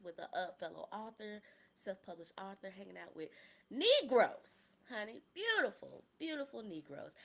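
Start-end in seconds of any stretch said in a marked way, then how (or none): 3.7-5.2 high, loud celebratory punch; joyful outburst, falling at the end; gleeful exclamation. Real, not acted. Gleeful emphasis in her story. 5.3-7.1 high, loud admiring exclamation; gushing delight. Real, not acted. Gleeful emphasis in her story.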